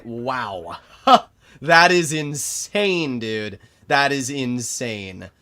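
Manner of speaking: loud, drawn-out disbelief; slow, loud exclamation, easing on the repeat; stunned outburst. Real, not acted. Streamer stunned by a no-damage shot, loud disbelief.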